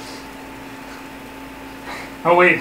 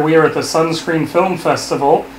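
ordinary talk, nothing marked

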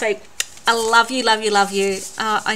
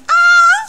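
short high, loud yelp; sudden shriek; 11 dB louder than usual. Real, not acted. Vlogger unsure when she started recording, sudden shriek.

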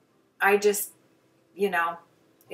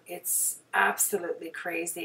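ordinary talk

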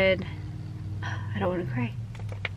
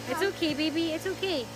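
higher and softer, tender soothing; 7 semitones higher, 10 dB quieter, slower than usual. Real, not acted. Comforting her toddler.

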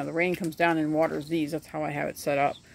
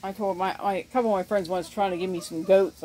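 usual speaking voice

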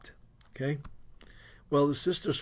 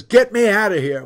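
loud, higher retold demand; mad wife; 4 semitones higher, 9 dB louder than usual. Wife demanding husband take her out of church, mad and loud. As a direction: imitate a fed-up wife demanding to leave. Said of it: "She's so mad".

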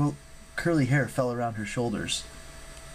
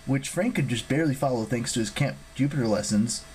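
ordinary delivery

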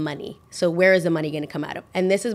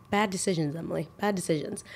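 much softer aside to herself; quiet self-scolding; 8 dB quieter than usual. Real, not acted. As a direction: mutter a rebuke at yourself. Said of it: "scolding herself aside".

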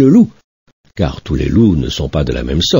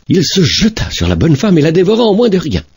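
ordinary delivery, nothing marked

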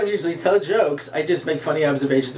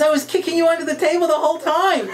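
high-pitched, indignant scolding; exasperated wife; 10 semitones higher than usual. Acted. Joke wife to husband about secret signals, high and exasperated. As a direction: hammer it out high in mock exasperation.

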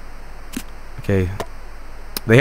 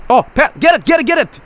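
high, excited repeated cries; worked-up gamer commands; urgent urging; 9 semitones higher than usual. Real, not acted. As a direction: egg the player on frantically. Chasing puck.

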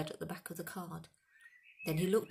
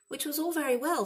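1 wry thought; 10 semitones higher, 7 dB louder than usual. A narrator's reading.

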